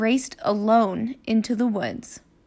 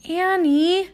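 high, far-reaching call; 7 semitones higher than usual. Acted. Jack calls up to Annie in the treehouse, high. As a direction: call up into the tree.

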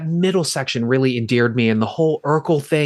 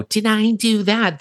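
high, slow nerdy Urkel catchphrase; Steve Urkel impression; 8 semitones higher, slower than usual. Leaning over to Scooter, a high Steve Urkel voice. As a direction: squeak it out like Urkel. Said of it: "Steve Urkel and all of that stuff".

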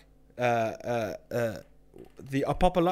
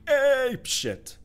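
exasperated outburst; 10 semitones higher, 5 dB louder than usual. Real, not acted. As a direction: pitch jumps up on ape shit, louder. Ranting about barking dogs.

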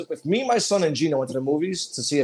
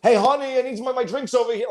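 mocking frat boy, pitched higher; douchebag frat boy; 9 semitones higher than usual. Mocking a frat boy calling the bartender, pitched higher. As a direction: sneer it like an entitled bro at the bar. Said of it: "every fucking douchebag frat boy you see at a bar who's like".

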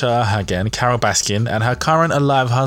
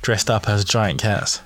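normal talking voice

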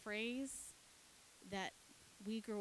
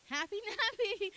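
high, loud mock-cheery sell; hammed-up enthusiasm for a child; upbeat framing; 11 semitones higher, 8 dB louder than usual. Shown as a demonstration. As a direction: pitch it way up and sell it brightly. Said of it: "Sometimes it's all how you frame it".

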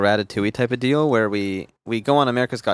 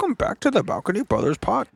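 much higher put-on host voice; impression of Josh; 10 semitones higher than usual. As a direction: squeeze the intro up high like a mimic. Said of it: "That was such a good impression of Josh".

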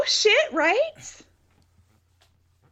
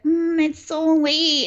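higher, drawn-out sleepy mumble, put on; pretend just-woken; 5 semitones higher, slower than usual. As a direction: feign being woken from sleep, slowly. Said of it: "pretends that he woke her up".

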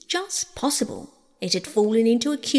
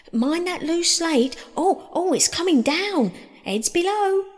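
high-pitched alarmed calling; rising cries of warning; urgent warning shout; 10 semitones higher, 4 dB louder than usual. A narrator's reading. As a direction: warn everyone below of falling slate. Warning as slate falls from roof.

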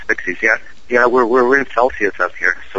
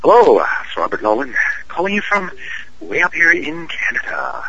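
Canadian voice; 6 semitones higher than usual. Shown as a demonstration. Guest repeating his call on request, hammed-up Canadian voice. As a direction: ham up the Canadian accent in separate phrases. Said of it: "do it one more time".

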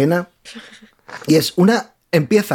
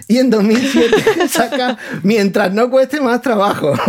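laughing; 5 semitones higher than usual. Real, not acted. Mocking the near-identical album title.

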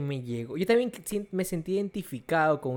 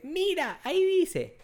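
higher, lightly voiced puzzled mother; mom confused; 12 semitones higher, slower than usual. Retelling his mother's confusion, higher puzzled voice. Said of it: "Y dice".

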